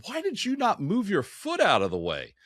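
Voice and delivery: high, slow, needling retort; technician's comeback. Retold or imitated. Technician needles the economist in the bar joke, high and slow. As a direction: pitch it way up and draw out the jab. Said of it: "he looks at the economist and goes".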